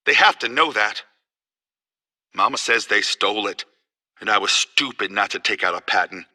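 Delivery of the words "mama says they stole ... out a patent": low, soft, dejected; small, downcast voice talking to yourself; subdued self-reproach. Acted. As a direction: blame yourself under your breath. Wronged man talking to himself.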